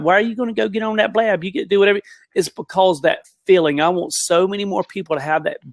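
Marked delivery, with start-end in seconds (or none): 0.0-2.0 higher mock country accent. Country folk questioning the blab, mock country accent. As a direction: poke fun at country folk in their own country accent. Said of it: "making fun of other country people by talking like that".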